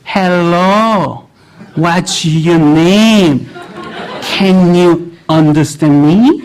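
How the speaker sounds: put-on very slow, overly careful English, like talking to a toddler; talking down to a child. Classmates treat the foreign student like a child, drawn-out patronising English. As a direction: drag out each word as if he can't understand. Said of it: "애 취급해요".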